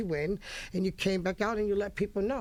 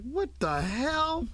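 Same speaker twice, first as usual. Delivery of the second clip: high, drawn-out disbelief; baffled disbelief; 7 semitones higher, much slower than usual. Retold or imitated. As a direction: stretch it out slowly, thrown by what you heard. Acted baffled reaction to the song.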